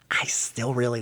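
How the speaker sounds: hushed, unvoiced admission; whispered confession. Real, not acted. Host confides to listeners despite the hate, whispering. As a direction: whisper it like a guilty secret.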